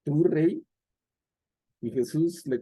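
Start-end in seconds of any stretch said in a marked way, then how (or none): 0.0-0.6 mocking tone. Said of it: "en ese tono burlón le dice".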